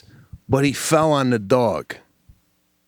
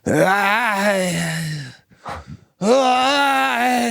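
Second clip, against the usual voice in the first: long, drawn-out mock groan, rising higher; imitated groan getting up; 5 semitones higher than usual. Fallen old hiker getting up, imitated wailing groan. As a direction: groan like struggling to your feet. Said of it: "as he's getting up".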